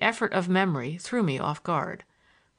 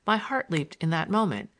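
usual speaking voice

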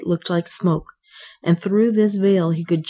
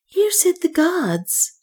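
high, slow, solemn priestly voice; 13 semitones higher, slower than usual. A narrator's reading. High priest Nam speaks.

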